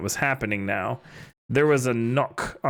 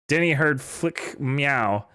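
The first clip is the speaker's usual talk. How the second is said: ordinary talk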